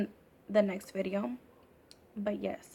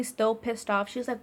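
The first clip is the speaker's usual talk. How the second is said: ordinary delivery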